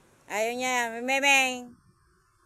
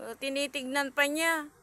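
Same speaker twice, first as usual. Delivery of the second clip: ordinary talk, nothing marked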